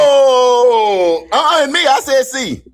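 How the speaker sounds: long high wailed "oh", then insistent; high, loud outburst of objection; loud protest. Real, not acted. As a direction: cry out as if cheated. Contestant protests the announced answer.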